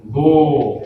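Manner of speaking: गो said alone, clear and held; गो as a teacher's sample sound; model pronunciation. Shown as a demonstration. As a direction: give the class गो to copy. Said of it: "तो क्या होगा".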